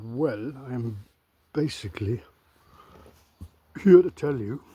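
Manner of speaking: a light Welsh lilt on here to tell you; Welsh accent. Shown as a demonstration. To his viewers, showing off a Welsh accent. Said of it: "I said it with a Welsh accent".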